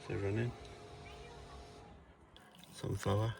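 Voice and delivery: ordinary talk, nothing marked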